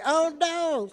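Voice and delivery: high, loud sassy "oh no"; mock-scandalized, playful sass. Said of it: "like, just sassy".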